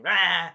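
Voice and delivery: short, loud imitated roar; dragon roar. Dragon lunges at the hero, loud mock roar.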